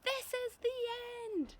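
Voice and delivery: high, slow wailing cry; despairing cry. Acted. Old green grasshopper wailing as the peach plunges, slow and high. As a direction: drag out the doom. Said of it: "cried the old green grasshopper".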